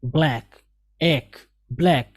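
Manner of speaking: ब्लैक as one syllable, no extra vowel; ब्लैक in one clean syllable. Shown as a demonstration. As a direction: keep ब्लैक tight, bl together. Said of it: "और इसको ब्लैक".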